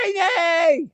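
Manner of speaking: high, drawn-out mock shriek; Ernie's nervous scream. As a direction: voice jumps way up high. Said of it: "his voice goes up another octave when he's nervous".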